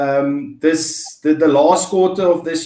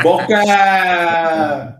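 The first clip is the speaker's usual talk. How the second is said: long, held playful holler; playful stretched-out announcement; drawn-out call. Pretend. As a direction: call it out, stretching the last vowel way out. Playful call opening the show.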